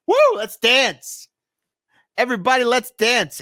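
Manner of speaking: high, bubbly party call to the crowd; mock AOC cheer. Streamer mocking AOC calling everyone to dance, high and gleeful. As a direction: ham up a giddy invitation to dance. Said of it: "She said".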